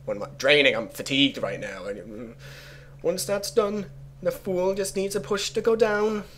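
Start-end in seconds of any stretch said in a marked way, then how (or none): 3.0-6.2 high, steady put-on accent, vaguely Jamaican; bad pseudo-Jamaican accent. Player reading the plot against Timsh, strained pseudo-Jamaican accent. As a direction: labour to keep up a Jamaican-ish accent. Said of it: "I don't even know what this accent is".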